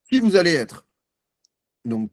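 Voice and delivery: ordinary delivery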